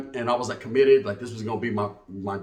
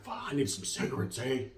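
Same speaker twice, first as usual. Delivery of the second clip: a hushed, voiceless grumble to yourself; whispered craving; 5 semitones lower, 12 dB quieter than usual. Retold or imitated. A young man at his aunt's house, whispering that he needs cigarettes. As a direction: whisper it like a secret need.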